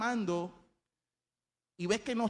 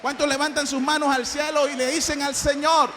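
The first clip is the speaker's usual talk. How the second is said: loud, high preacher's call; rallying the congregation; 8 semitones higher, 9 dB louder than usual. Real, not acted. Preacher asks the congregation to raise their voices, loud and rallying. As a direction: rouse the whole church.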